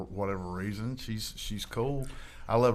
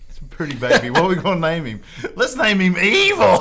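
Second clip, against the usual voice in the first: mock parent voice; 7 semitones higher, 12 dB louder than usual. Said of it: "like Evel Knievel".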